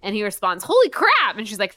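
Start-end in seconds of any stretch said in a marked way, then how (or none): 0.6-1.4 much higher and louder holy crap; shocked exclamation. Retold or imitated. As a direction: blurt it out in shock.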